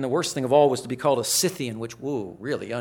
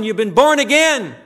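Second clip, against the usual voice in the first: full-force preaching; 10 semitones higher, 13 dB louder than usual. Acted. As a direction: preach it out loud and high, in full fervour. Preaching verse twelve.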